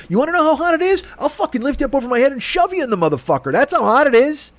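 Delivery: ordinary talk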